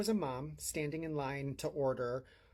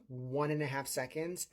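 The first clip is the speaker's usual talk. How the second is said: ordinary talk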